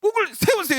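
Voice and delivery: loud, high, forceful order; ignorantly loud command; blunt command. Retold or imitated. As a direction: bark the order without a clue. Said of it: "했는데 무식하게, 뭘 모르니까".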